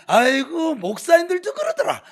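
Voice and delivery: high, loud imitation of a shameless excuse-maker; mock brazen excuse. Sinner excusing themselves to the pastor, mock brazen. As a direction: exclaim it as if that settles everything. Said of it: "뻔뻔스럽게".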